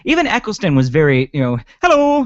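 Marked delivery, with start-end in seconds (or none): 1.8-2.2 high, loud, cheery mock greeting; very cheerful. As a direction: sing out a mock-chirpy hello, pitched way up. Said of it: "very cheerful at times".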